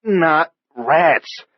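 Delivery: put-on young man correcting his partner, adamant. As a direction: insist firmly. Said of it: "Andy said adamantly".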